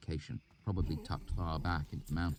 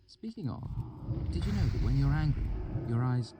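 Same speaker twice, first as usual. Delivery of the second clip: put-on higher, curious young woman; lightly teasing, higher; Denner's voice; 9 semitones higher, 4 dB louder than usual. A narrator's reading. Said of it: "cocked her head".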